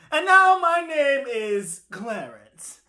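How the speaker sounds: high, loud, slowly stretched words; proclaiming bird. Acted. Bird declares new name to the others, high proclamation. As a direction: announce your new name grandly.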